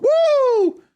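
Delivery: very high, loud whoop. Acted. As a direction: whoop as you run out. Acts running out of shop.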